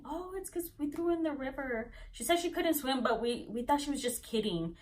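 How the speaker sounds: high, light mock-excuse voice; sheepish retold excuse; making excuses. Onlookers excusing themselves to mom.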